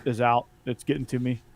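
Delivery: usual speaking voice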